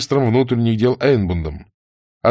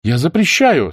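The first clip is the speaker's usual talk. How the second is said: raised-pitch prohibition; firm protest; 6 semitones higher, 4 dB louder than usual. A narrator's reading. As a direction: forbid them firmly. Nina forbids the soldiers. (решительно запрещая)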